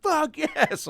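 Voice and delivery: high, hammed-up crowd whoop; be the whole bar egging him on; crowd cheering. The crowd urging the comic to kiss her, high mock cheer. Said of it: "And everybody's like".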